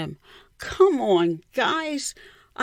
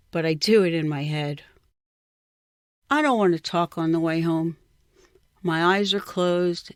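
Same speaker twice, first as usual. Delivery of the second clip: usual speaking voice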